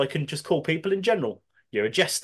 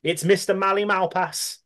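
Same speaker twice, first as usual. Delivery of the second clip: ordinary talk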